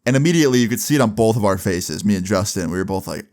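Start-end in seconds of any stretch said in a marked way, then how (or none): none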